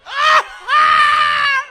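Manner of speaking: two very loud high screams; imitated deafening scream. Comic showing what the headphone man heard, deafening scream. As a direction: scream it at full blast. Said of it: "He heard".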